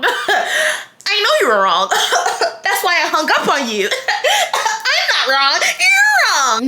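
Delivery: laughing through a mock scolding, high; giggly, drawn-out teasing. Real, not acted. Laughing as she teases her friend for being wrong.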